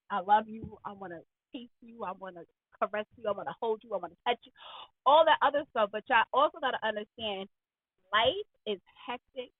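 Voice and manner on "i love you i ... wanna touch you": low, soft lover's murmur; hushed, intimate endearments; tender sweet talk. Acted. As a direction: coax a partner gently, voice dropped low. Acts out tender words to partner.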